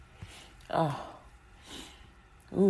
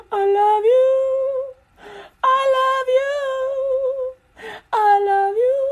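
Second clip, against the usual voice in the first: very high, loud, half-sung tearful love; sing-song mock crying; 4 dB louder than usual. Everyone in a circle trades love, tearful sing-song. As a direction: croon it round the circle.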